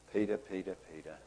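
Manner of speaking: soft, knowing repetition of a name; quiet reproof. Acted. Jesus, who knew better, answers Peter's boast, quietly reproving. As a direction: say it softly to someone who is overconfident.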